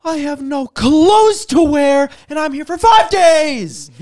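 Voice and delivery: high, loud, slow indignant whine; loud, slow, whiny traveler complaint; mock angry rant. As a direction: ham up an entitled tourist's outrage. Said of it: "The guy goes".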